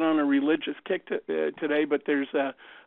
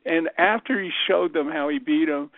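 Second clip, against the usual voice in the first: ordinary delivery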